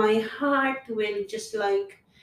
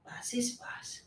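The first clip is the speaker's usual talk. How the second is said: breathy whisper to herself; soft unvoiced whisper telling herself to let go; whispered self-talk; 14 dB quieter than usual. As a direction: whisper it like a private vow. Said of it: "Just tell yourself".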